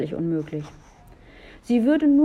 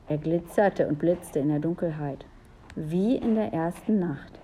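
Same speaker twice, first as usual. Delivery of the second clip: plain reading voice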